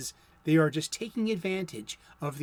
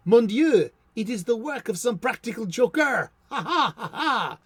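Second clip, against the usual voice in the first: hammed-up French accent, pitched higher; 5 semitones higher, 6 dB louder than usual. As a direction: play an over-the-top Frenchman laughing off a joke. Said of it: "That is my French accent".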